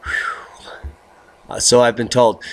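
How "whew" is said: whispered whew. Real, not acted. Exhale after the cold joke.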